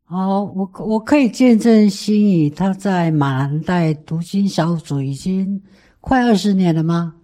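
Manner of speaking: ordinary delivery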